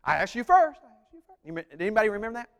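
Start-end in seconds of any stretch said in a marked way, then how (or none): 0.0-0.8 Grinch echo reply. Retold or imitated. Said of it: "like the Grinch in his cave". 0.8-1.4 whispered echo fading to nothing; faint whispered echo. Retold or imitated. Preacher imitating the Grinch's echo dying away. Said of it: "like the Grinch in his cave".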